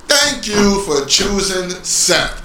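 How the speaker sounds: slow, drawn-out thank you; mock grateful thanks. Fan addressing Brock Lesnar, slow mock-grateful thanks. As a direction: thank him with hammed-up gratitude.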